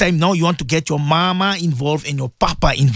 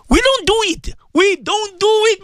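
emphatic high protest; 13 semitones higher than usual. Real, not acted. Preacher scolding his listeners.

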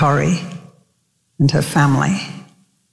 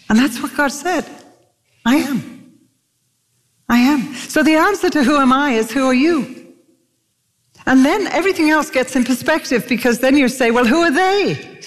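high-pitched, urgent sermon delivery; impassioned preaching; 9 semitones higher than usual. Real, not acted. Preacher urging the congregation with God's promise, impassioned preaching.

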